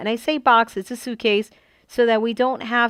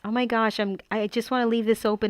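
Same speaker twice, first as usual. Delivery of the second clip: usual speaking voice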